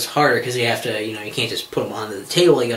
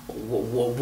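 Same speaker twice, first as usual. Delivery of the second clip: ordinary delivery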